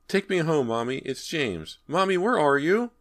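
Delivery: put-on high, pleading child's voice; lost child's high plea; frightened little boy. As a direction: call for mother like a trapped small boy. Trapped boy calls for his mother.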